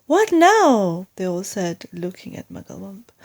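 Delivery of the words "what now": eager question. Acted. Animals ask eagerly.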